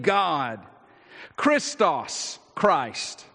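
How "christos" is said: Christos said KHREES-tos, stress on first syllable; Greek word. Shown as a demonstration. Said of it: "a Greek word".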